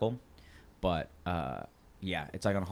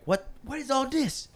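confused goon; slower than usual. Acted. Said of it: "one goon goes like".

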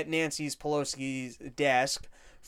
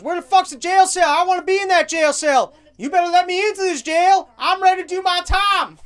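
much higher, loud hammed-up yelling; mock scream; 12 semitones higher, 9 dB louder than usual. Man demanding a cell from the cop, hammed-up scream. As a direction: demand the jail cell at the top of your lungs. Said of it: "he goes".

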